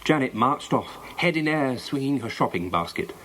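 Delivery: usual speaking voice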